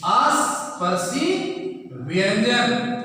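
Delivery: स्पर्शी व्यंजन drawn out very slowly, each word set apart; slow dictation of स्पर्शी व्यंजन; slow, spelled-out term. Shown as a demonstration. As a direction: say स्पर्शी व्यंजन slowly and deliberately so students catch it. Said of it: "देखिए".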